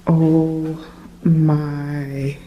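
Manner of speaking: ordinary talk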